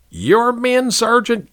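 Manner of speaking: high, mocking "Your men"; scornful question. A narrator's reading. Captain mocking the sergeant's claim in a high sneer. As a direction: sneer the words back at him.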